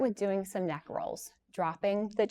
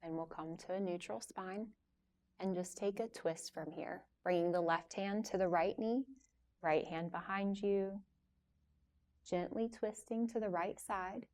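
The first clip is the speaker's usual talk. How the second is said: soft, unhurried yoga teacher voice with long pauses; guided yoga instruction; 8 dB quieter than usual. Real, not acted. Yoga teacher leading a class through gentle stretches before relaxation, calm guiding voice. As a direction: guide them softly through each stretch, leaving room to move.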